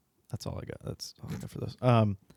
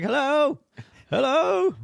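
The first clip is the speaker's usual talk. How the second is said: much higher, louder mock call from inside the grave; dead grandpa in the coffin; 6 dB louder, slower than usual. Telling a friend about the funeral recording, squeaky mock call. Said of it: "He's like".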